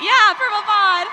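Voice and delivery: loud, high shout-out, slow and drawn out; rally the pod with a big cheer; excited cheer. Real, not acted. Guest cheering her pod in the audience, loud excited shout.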